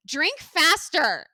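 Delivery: loud, slow mock command; very loud imitation. She orders him to drink on a flight, loud imitation. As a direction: drag out the order loudly, imitating her. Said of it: "she was talking, like, very loud".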